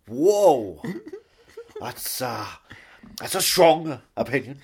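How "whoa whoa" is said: a high, loud, laughing whoa; mock shock. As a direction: gasp in pretend outrage while laughing. Mock shock at cheeky remark.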